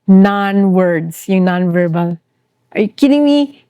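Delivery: ordinary talk, nothing marked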